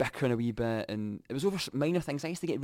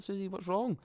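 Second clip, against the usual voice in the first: high, gentle worried voice; concerned question; 10 semitones higher than usual. Retold or imitated. To his crying girlfriend, a high gentle worried ask.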